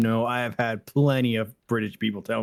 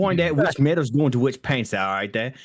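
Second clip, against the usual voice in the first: a bad British accent that slides into Australian; faked British accent, half Aussie; 5 semitones higher than usual. Player rereading his game line in a wobbly British accent. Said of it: "I have the best British accent".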